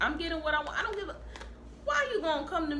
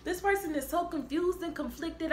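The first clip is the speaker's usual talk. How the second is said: normal talking voice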